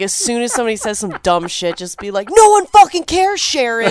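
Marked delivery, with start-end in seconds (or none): 2.3-3.9 high, loud, stretched-out outburst; exasperated imitation of a screamer; mock scream. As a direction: yell it to shut a relative down. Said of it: "you just scream whatever is".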